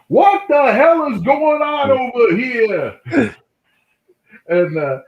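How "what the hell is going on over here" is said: high, loud, slow mock bewildered yell; Billy bursting in. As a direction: play the excited friend barging over. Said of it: "is like".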